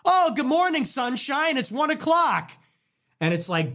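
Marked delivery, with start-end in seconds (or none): 0.1-2.5 high, mocking wake-up call; teasing dad. Dad teasing his son who woke at one, high and mocking. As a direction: needle the late-sleeping son.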